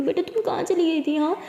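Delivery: mother hugging her daughter, high and frantic; emotional mother. Acted. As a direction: pour out a mother's panic. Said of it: "बहुत ही ज़्यादा emotional हो जाती है".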